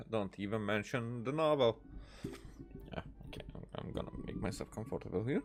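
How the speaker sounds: ordinary delivery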